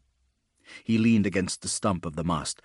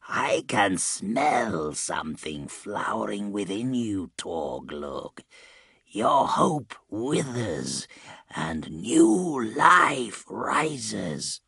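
very slow, ominous villain voice; sinister Rotbringer; much slower than usual. A narrator's reading. Rotbringer closing in on his victim, sinister. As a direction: savour every word, stretching it out as you build.

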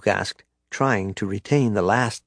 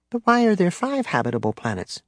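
put-on child voice; 8 semitones higher than usual. A narrator's reading. Child Jacen voiced by narrator.

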